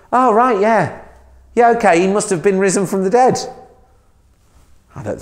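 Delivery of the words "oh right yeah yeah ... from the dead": higher, airy sarcastic voice; a sarcastic send-up of easy belief; mock naive acceptance. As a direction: play the gullible believer, tongue in cheek. Mocking an unlikely first reaction.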